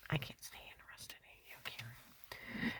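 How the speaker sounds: ex-husband rejecting her, whispered; husband's words. Retold or imitated. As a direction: let it sink to a whisper.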